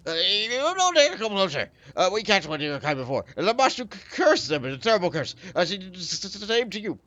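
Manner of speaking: high shaky goblin voice, stammering; quivering goblin howl. Acted. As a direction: howl it out, scared but defiant. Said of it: "howls at you in a quivering voice".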